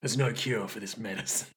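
low, soft brooding voice; hammy low menace; mock-dramatic doctor-hater. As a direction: intone it darkly and quietly. Continues the mock-dramatic character.

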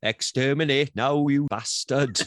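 loud, hammy Dalek shout. Pretend. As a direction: bark it like a Dalek.

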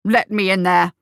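loud, struggling demand; protesting. A narrator's reading. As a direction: protest forcefully while held back. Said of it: "protested the captain".

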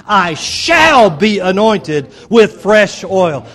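"shall" stretched long and shouted; stress on SHALL. Shown as a demonstration. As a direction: belt out "shall" high and long, slow declaration. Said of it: "emphasize the word "shall".

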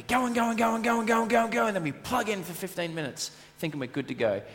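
"go and go" rattled off high and fast; rushed repeat. Shown as a demonstration. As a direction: race breathlessly through "go and go and go". Repeats the routine sped up.

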